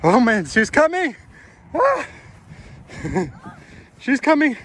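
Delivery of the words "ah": startled yelp. Real, not acted. Chased by daughter during game.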